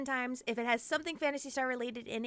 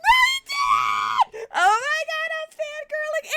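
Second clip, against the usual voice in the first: shrieking, much higher and louder; fangirl shout; 10 dB louder, slower than usual. Real, not acted. Streamer greeting her idol Hellscream, squealing shout. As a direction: scream it out like a starstruck fan.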